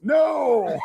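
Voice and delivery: high, drawn-out cry of refusal; hammed-up wail, imitating a horrified coworker; playful imitation of a protesting yell; mock anguished shout. Retold refusal from upset Bob.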